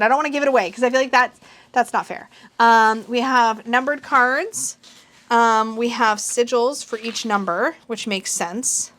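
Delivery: usual speaking voice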